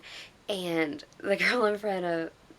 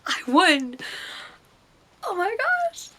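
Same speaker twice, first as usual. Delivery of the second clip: loud, high-pitched squeal of joy; ecstatic winner; 12 semitones higher, 7 dB louder, slower than usual. Retold or imitated. Fan reliving her photo contest win, ecstatic. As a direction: squeal it like you just won. Reliving learning she won prize.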